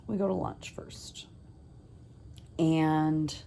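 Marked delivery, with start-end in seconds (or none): 0.0-1.2 whisper. Real, not acted. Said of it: "whispered self-correction".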